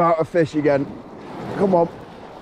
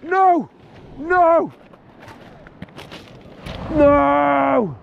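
anguished cry; 12 semitones higher, much slower than usual. Real, not acted. Angler losing a fish of a lifetime, anguished cries. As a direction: howl as the fish gets away.

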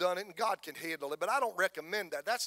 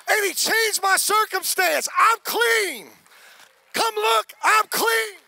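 high, loud shouted joy; healed man shouting he is clean; joyful testimony; 9 semitones higher, 9 dB louder than usual. Acted. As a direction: proclaim it to everyone who knew you. Healed leper tells the crowd.

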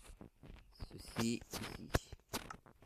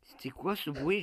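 startled, voice jumps up; spooked by a sudden noise; scared horror game streamer; 5 semitones higher, 5 dB louder than usual. Real, not acted. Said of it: "J'ai eu très peur".

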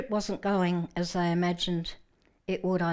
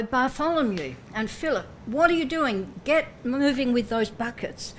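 loud, higher shout across the deck; shouted orders; 5 semitones higher, 6 dB louder than usual. Retold or imitated. Peter yelling at the crew to bail, loud shout. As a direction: yell at the crew to move. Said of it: "He might have said".

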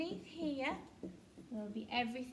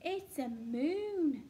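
slow reveal; 2 semitones higher, 5 dB louder, much slower than usual. Shown as a demonstration. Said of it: "teacher reveals answer slowly".

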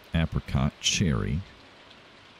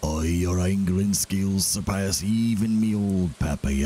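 slow, drawn-out character voice with dialect vowels; Willie; slower than usual. Acted. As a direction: stretch it out as an old-salt character. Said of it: "I gotta read it in Willie's voice".

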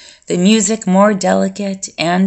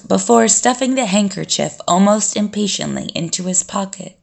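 plain reading voice

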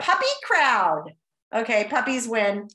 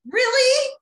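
incredulous; 9 semitones higher than usual. Real, not acted. Puppies beating best friends.

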